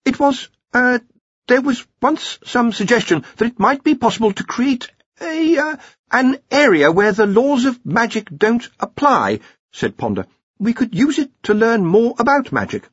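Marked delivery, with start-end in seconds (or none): 0.1-9.4 high, hesitant explanation; nervous Ponder. A narrator's reading. 10.6-12.9 high-pitched, anxious underling; nervous Ponder. A narrator's reading. Ponder in story.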